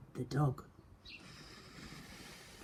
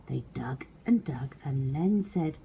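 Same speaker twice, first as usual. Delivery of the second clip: ordinary delivery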